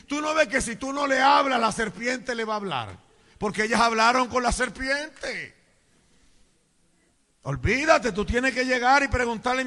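loud scolding exhortation to husbands; full-force preaching. Acted. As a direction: urge the men forcefully from the pulpit.

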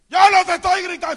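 very loud, high, fast retort; mock husband's defensive shout; acted shouted denial. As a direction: yell that you are not yelling. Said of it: "parece que estamos gritando".

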